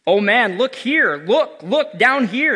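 loud, high, slow spirit cry; exclaiming ghost. Acted. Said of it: "exclaimed the ghost".

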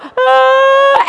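high, held pretend bawl; imitating a crybaby's howl; loud mock crying wail. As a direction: burst into a hammed-up wail. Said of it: "And his first job".

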